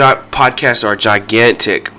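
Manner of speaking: ordinary talk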